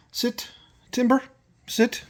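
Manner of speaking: high firm call to the dog; dog command. Real, not acted. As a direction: tell the dog to sit.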